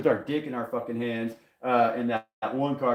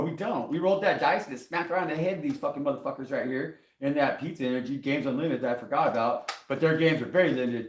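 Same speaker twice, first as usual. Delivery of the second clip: ordinary talk, nothing marked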